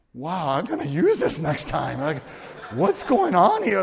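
loud, rising mock astonishment; amazed reaction. Young helper watching the kid weep at foot washing, astonished. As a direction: blurt it out, climbing high and loud.

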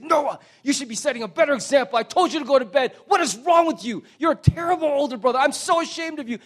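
high, loud, fast scolding; angry father yelling. Retold or imitated. Father bursting into his son's room, loud angry scolding. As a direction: tear into the boy at full volume.

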